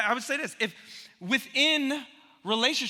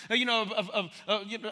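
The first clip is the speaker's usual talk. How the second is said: ordinary talk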